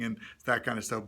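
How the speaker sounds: ordinary delivery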